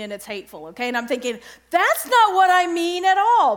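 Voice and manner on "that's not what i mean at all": higher, louder indignant objection; mock protest. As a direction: protest loudly as if wrongly accused. Acts out her protesting thought.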